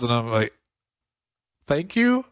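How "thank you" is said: high-pitched, questioning thanks; retold bewildered thanks after a pause; unsure reply. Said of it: "there's, like, a pause".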